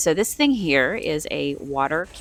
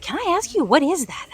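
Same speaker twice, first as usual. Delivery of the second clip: much higher, louder nosy stranger voice; curious lady; 12 semitones higher, 6 dB louder than usual. Retold or imitated. Shopper imitating a curious lady higher and louder. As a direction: imitate a surprised stranger asking. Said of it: "a lady is like".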